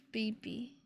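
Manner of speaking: soft unvoiced whisper. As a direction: breathe it like a secret. Whispered endearment.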